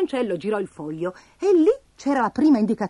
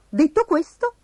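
ordinary delivery

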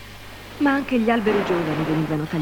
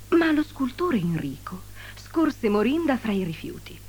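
ordinary delivery, nothing marked

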